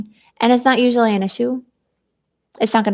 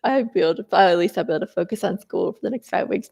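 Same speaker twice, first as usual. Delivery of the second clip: usual speaking voice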